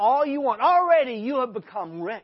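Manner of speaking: loud, mocking sarcasm; sarcastic. Preacher mocking the proud Corinthian church, sarcastic. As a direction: throw it at them with biting sarcasm. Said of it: "Do you hear the sarcasm in these words".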